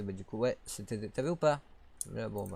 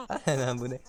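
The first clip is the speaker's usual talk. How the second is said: ordinary talk, nothing marked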